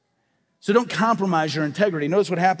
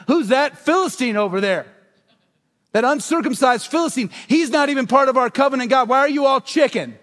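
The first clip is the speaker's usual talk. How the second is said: loud, higher, defiant taunt; young David taunting; 7 semitones higher, 5 dB louder than usual. Acted. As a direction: shout it at the giant like a brave shepherd boy. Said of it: "and he's like".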